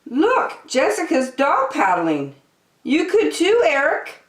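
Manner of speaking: very high, delighted mother's voice; high bubbly mom, coaxing at the end; excited mom. A narrator's reading. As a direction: show off the little one, then urge Eric. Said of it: "his mom said".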